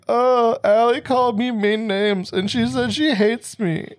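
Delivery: high, slow whimpering complaint, half-hearted; imitating a weepy, high-pitched tattle; mock crying whine. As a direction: whine it like a kid tattling through fake tears. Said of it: "she starts crying".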